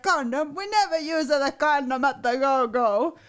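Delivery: puzzled Italian lover, faintly voiced; mild Italian accent, surprised "Condom?". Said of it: "I love the Italian accent".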